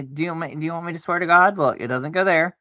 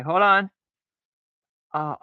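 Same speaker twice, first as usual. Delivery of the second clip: usual speaking voice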